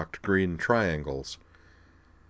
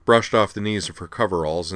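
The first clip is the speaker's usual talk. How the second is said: ordinary delivery, nothing marked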